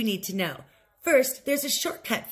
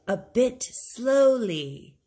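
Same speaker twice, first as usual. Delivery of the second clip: slow model phrase; 3 dB louder, much slower than usual. Shown as a demonstration. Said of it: "Say the phrase after [name]".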